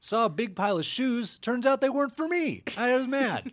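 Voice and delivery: high, petulant complaining voice; whiny complainer voice. Pretend. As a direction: pitch up, sulky and loud. Mocking clueless museum reviewer.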